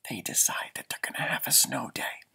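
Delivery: hushed and breathy, sharing a secret; a playful whisper to young listeners. Acted. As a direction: whisper it to the children. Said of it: "I'm gonna give you a little secret".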